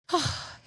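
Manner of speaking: airy, voiceless sigh; lovelorn sigh. Real, not acted. Woman thinks of how tall he was, sighing.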